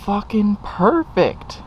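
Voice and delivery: high, slow, thrilled; drawn-out triumphant praise; delighted exclaim. Real, not acted. Triumphant exclaim over fitting bolts.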